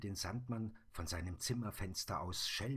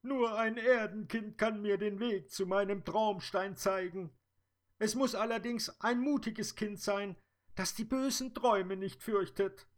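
high, louder fairy voice; sad fairy; 14 semitones higher, 7 dB louder than usual. Acted. Dream fairy explaining to the Sandman, high and louder. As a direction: speak as the tearful dream fairy.

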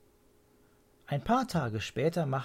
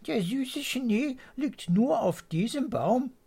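high, louder questioning bird voice; wondering swallow; 13 semitones higher, 4 dB louder than usual. A narrator's reading. Mr Swallow notices only one tree, high wondering question.

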